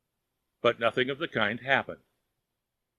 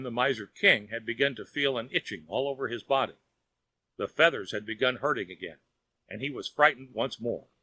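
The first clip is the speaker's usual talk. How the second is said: usual speaking voice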